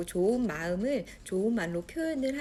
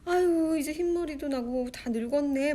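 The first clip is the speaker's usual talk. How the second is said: high, loud sighing lament; mock grumbling; 6 semitones higher, 4 dB louder than usual. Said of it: "막 푸념을 했나 봐요". (푸념하듯 한탄하는 말투)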